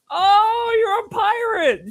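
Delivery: very high, drawn-out mock realisation; delighted old lady. Old lady finally understanding the costume, delighted. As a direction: swoop up loud on the oh, then stretch it.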